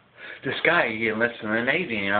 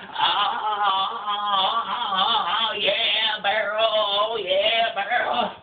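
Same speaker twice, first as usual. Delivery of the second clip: high, drawn-out moan; mock moaning; 10 semitones higher, much slower than usual. Sailor going at the barrel, moaning higher in mock pleasure.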